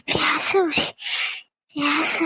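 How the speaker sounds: slow, crying words through a dry throat; a sick mother breaking up as she speaks to her son; weak and tearful. Acted. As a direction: struggle to get the words out. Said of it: "தொண்டெல்லாம் வறண்டு போற மாதிரி இருக்கு".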